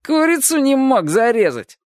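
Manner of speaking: high-pitched snide jab; snide taunt. A narrator's reading. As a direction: taunt him like a sneering schoolboy. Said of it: "ехидно улыбаясь".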